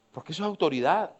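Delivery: usual speaking voice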